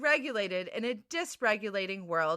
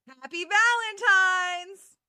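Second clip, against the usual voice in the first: excited cheer; 7 semitones higher, 5 dB louder, slower than usual. Acted. Bright sign-off of cold open.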